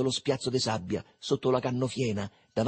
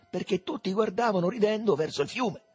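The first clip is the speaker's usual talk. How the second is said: ordinary delivery, nothing marked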